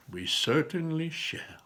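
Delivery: slow unvoiced hushed reply; whisper. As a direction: breathe it slowly and softly. Hushed reply before climbing.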